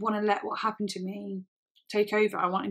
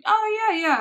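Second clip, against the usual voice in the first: much higher, louder mock agreement; exaggerated high-pitched brush-off; deflecting; 12 semitones higher, 9 dB louder than usual. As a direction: imitate a friend dodging with a quick yes. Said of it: "really deflecting".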